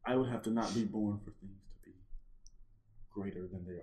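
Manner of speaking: hushed, barely audible aside; quick whisper. Real, not acted. Prompting co-host with the offer, quick whisper. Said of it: "Just quick whisper".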